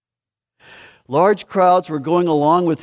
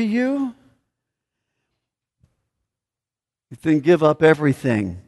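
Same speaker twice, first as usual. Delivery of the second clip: forceful Jesus; 3 semitones higher, 4 dB louder than usual. Acted. Preacher voicing Jesus' challenge.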